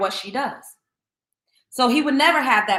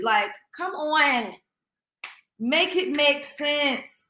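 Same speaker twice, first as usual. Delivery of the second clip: exasperated; 6 semitones higher, much slower than usual. Real, not acted. Exasperated plea, drawn out.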